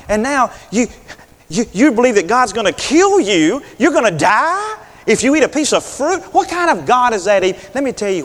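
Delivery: high, coaxing serpent voice, pushing Eve; sly tempter egging her on; a serpent sweet-talking Eve, high and insistent; tempting serpent. Acted.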